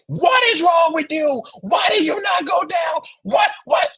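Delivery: high, exasperated shouting at the player; furious yelling. Retold or imitated. Fan in the venue yelling at Dre for not going down, high exasperated shout. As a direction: yell the disbelief at the runner. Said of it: "That was me in that event".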